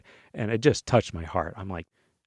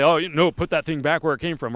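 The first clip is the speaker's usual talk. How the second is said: mock scolding nurses; 6 semitones higher than usual. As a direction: ham up an outraged official. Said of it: "They're gonna say".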